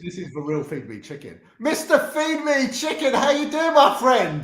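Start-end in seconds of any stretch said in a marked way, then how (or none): 1.6-4.4 loud, high-pitched hail to a friend; excited greeting. Real, not acted. Streamer greeting the chicken guest, loud high excited hail. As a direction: call out the name big and high.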